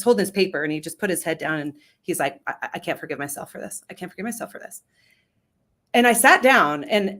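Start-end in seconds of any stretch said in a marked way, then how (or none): 2.5-4.8 subdued confession. Retold or imitated. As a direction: quietly voice a man's pained admission. Man confesses with head down.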